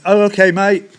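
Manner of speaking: high, loud surprised reply; a sudden loud surprised okay; startled okay. Retold or imitated. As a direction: blurt it out, caught off guard. His own startled reply.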